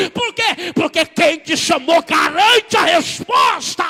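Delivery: loud, high preaching cry, stretched out; full-force preaching. Acted. Preacher shouting God's words to the church, full force. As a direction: preach it at the top of your voice.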